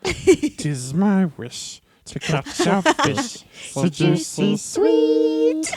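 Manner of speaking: slow, sing-song creature voice; mock Gollum. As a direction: croon it like Gollum. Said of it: "I always think, like".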